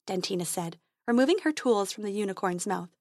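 usual speaking voice

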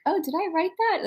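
high-pitched puzzled self-quote; mock surprise. Author hearing her own work read, acted high-pitched surprise.

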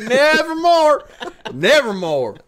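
Deep South Poe. Acted. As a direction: stretch it out like a Southern yokel. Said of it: "Edgar Allan Poe if he was from the Deep South".

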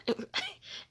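very high laughing squeal; high squeal of laughter. Real, not acted. Laughing at the absurd brides, high squealing laugh.

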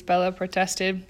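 plain reading voice

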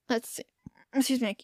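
burping between words; burping after fizzy drink. Real, not acted. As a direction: speak through rising burps. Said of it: "I keep burping".

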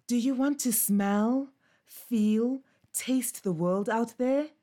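a restless pup longing to roam; eager puppy Bobo. Acted. Bobo tempts Ninja at the hole.

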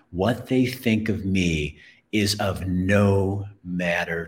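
slow, deliberate conviction; emphatic declaration. Acted. Storyteller declares others' opinions don't matter, slow and emphatic. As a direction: weigh out each phrase slowly.

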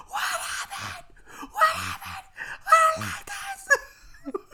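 very high, squeaky wail; imitated crying child. Brother just pulled from the gap, imitated crying. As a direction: blubber like a scared little boy. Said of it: "He's, like, crying".

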